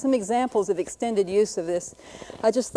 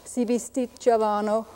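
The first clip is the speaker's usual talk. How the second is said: reading in Italian. Shown as a demonstration. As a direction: read it haltingly in Italian, as a self-admitted amateur. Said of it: "Let's try Italian".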